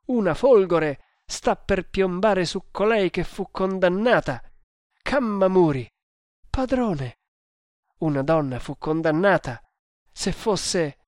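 agitated storybook hero; alarmed hero. Acted. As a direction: call out as the dreadful truth sinks in. Hero realises the threat, alarmed.

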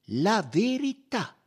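high, loud, drawn-out VERITÀ; slow, emphatic insistence on "La verità"; firm demand. A narrator's reading. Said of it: "replicò Poirot con fermezza".

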